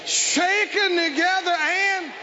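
slow, ringing proclamation with stretched words; emphatic preacher pausing after each phrase; fervent preaching. Acted. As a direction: build the sermon to a drawn-out peak. Preaches at full force.

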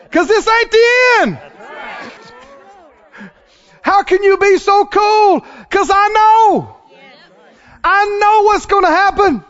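high-pitched, triumphant pulpit cry; impassioned, high sermon climax; exultant preaching cry. Real, not acted. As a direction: cry it out to the whole church.

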